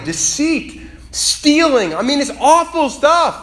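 high, loud, impassioned preaching; preaching at full force. Acted. Preacher driving the point home to the congregation, fiery and loud. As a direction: climb to a fiery peak.

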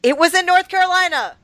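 high, loud exclamation; emphatic exclamation. Real, not acted. Storyteller defending the smoker story, emphatic exclamation. Protesting punchline in banter.

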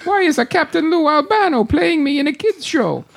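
high mock Italian whine; cartoon Italian accent. Game hero complaining about his casting, cartoon Italian accent.

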